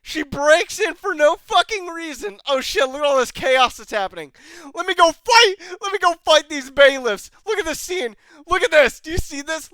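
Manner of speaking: high, loud, agitated outburst; incredulous rant. Real, not acted. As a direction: rant at the screen in loud disbelief. Incredulous rant at action scene.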